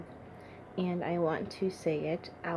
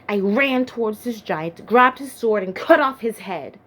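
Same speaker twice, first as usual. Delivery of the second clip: loud, surging, raised-pitch attack; frenzied climax; 3 semitones higher, 11 dB louder than usual. Acted. David reliving the kill, loud and surging. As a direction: build to a near shout with each action.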